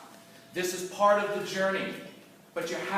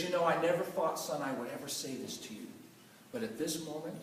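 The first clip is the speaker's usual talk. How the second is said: low and much softer, heavy; grave quiet; 3 semitones lower, 8 dB quieter than usual. Retold or imitated. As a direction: deliver the crushing words quietly. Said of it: "the most damaging words".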